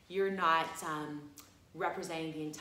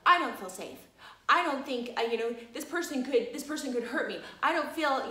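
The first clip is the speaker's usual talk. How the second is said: mock freaking out; 6 semitones higher, 6 dB louder than usual. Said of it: "was, like, freaking out".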